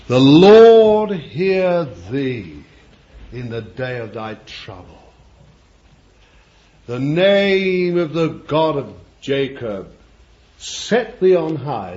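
slow, solemn psalm reading; scripture reading. Acted. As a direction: intone the psalm. Reads psalm aloud.

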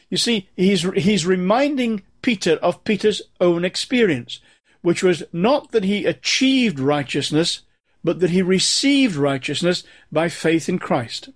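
ordinary delivery

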